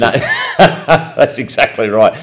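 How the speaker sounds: laughing agreement. Real, not acted. Co-host agreeing after the beer and Skittles remark, laughing. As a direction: laugh and agree heartily through it.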